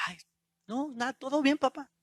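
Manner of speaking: put-on quiet, slightly small voice of a child; soft child's reply. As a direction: play down the worry to dad, softly. Voices child reading dad's gesture.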